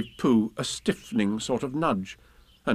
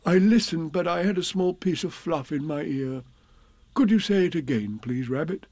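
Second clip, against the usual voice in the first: higher, apologetic bear voice; sheepish Pooh; 5 semitones higher than usual. Acted. Pooh explaining to Rabbit why he missed it, sheepish. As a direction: excuse yourself, then ask politely.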